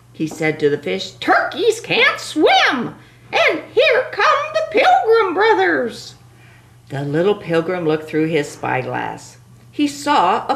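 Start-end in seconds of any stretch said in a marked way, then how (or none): 1.1-6.2 panicky turkey voice. Acted.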